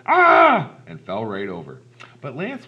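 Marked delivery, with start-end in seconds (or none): 0.1-0.7 very loud, high-pitched scream; frightened yell. Acted. Lady seeing Lance's scary face, frightened scream. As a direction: shriek at the sudden scare. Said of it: "The lady yelled".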